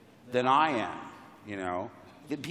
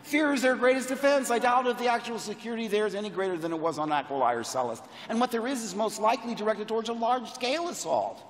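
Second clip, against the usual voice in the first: put-on higher-pitched, urgent young pilot reciting jargon; earnest young hero; 6 semitones higher than usual. Young pilot arguing with the smuggler in a screen test, earnest and urgent. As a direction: plead it earnestly and rattle through the technical middle.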